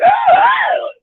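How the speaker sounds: loud, high-pitched mock holler; two hammed-up shrieks; high mock scream. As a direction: squeal it out in parody. Imitates screaming comedy on screen.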